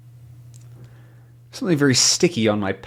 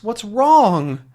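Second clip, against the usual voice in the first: alarmed question; 8 semitones higher, 4 dB louder than usual. Acted. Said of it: "he asks".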